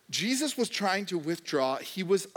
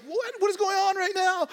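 much higher, louder bewildered cry; frantic confusion; 5 dB louder than usual. Retold or imitated. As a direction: act out being overwhelmed by chaos. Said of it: "and you're like".

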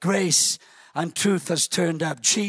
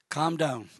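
gentle calming; 5 semitones lower, 4 dB quieter than usual. Acted. Jesus calming Mary softly.

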